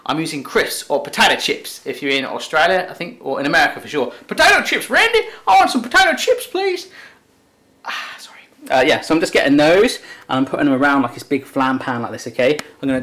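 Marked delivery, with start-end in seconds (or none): 4.3-6.9 much higher, mock American kid voice; a hammed-up American asking for snacks; rubbish American accent. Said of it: "That is a rubbish accent". 7.8-8.4 whispered apology; whispered aside. Real, not acted. Aside after the put-on voice.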